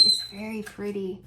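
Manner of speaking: ordinary delivery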